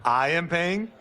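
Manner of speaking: can't believe he's stuck with the bill; indignant surprise. Real, not acted. Told he pays for dinner.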